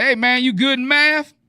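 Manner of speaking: high, loud singsong check-in; high-pitched acted query; mock casual question. Acts out a cellmate exchange.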